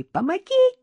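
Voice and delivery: very high-pitched squeal for help; frightened squeak. A narrator's reading. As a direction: squeak it out in panic. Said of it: "пискнула та". (испуганно пискнув)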